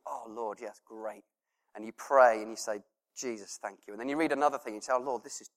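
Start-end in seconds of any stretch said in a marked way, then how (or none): 0.1-1.2 very soft, near-whispered awe; hushed prayer. Pretend. 3.1-3.9 very soft, near-whispered awe; hushed prayer. Pretend. 4.9-5.5 very soft, near-whispered awe; hushed prayer. Pretend.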